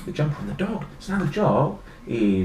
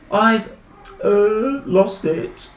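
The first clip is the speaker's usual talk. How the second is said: put-on halting, higher-pitched guilty boy; stammering; 8 semitones higher, 7 dB louder, much slower than usual. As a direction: stall and stumble over a fib. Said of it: "he stammered".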